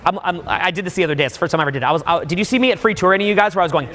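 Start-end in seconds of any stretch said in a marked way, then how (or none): none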